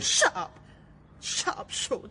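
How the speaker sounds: high, sharp order to shut up; angry shout. Acted. Kelly silences the officer, angrily shouting. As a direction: cut him off hard. Hostage-taker snaps at captive.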